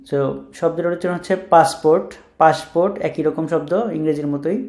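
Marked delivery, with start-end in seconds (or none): none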